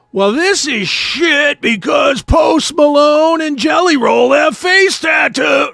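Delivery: put-on loud, slow, ranting old-timer; grumpy complainer shout. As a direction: shout it like an outraged rock fan. Mocking complaining rock fan.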